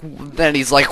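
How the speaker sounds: normal talking voice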